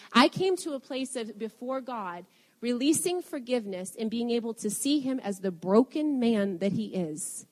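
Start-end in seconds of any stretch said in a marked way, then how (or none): none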